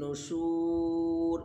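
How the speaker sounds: very slow, drawn-out নুশুর; chanted Arabic drill; slow recitation. Shown as a demonstration. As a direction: stretch নুশুর. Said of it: "প্রত্যেকটা উচ্চারণ".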